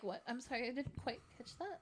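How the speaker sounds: very soft, timid apology; meek retold reply. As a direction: shrink back and apologise very quietly. Meek reply to yelling caller.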